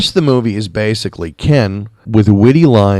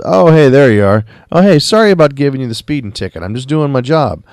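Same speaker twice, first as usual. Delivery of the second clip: lightly voiced sheriff, higher and louder at first; mock casual hail, pitched up; friendly sheriff greeting; 5 semitones higher, 4 dB louder than usual. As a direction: imitate a sheriff calling out an apology. Acted sheriff greeting the cook.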